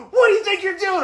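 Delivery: loud, very high-pitched driver impression; mock angry yell. Driver confronting narrator beside his car, hammed-up fury. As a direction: holler it in an exaggerated outraged squawk.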